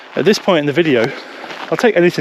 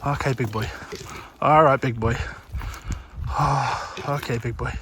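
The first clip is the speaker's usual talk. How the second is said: much softer, gentle calming murmur; soothing; 3 semitones lower, 11 dB quieter than usual. Real, not acted. To the displaying capercaillie, calming it: soft soothing murmur. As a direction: settle the big bird down softly.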